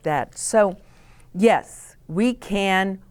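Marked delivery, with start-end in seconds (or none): none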